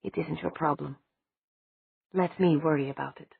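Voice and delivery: coldly. Acted. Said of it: "he spoke coldly".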